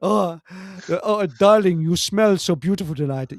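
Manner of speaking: hammed-up swooning lover; a lightly voiced suitor, smitten; mock romantic admirer. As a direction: gush over your darling. Mock romantic couple dialogue.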